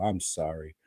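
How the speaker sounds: normal talking voice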